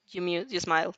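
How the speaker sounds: usual speaking voice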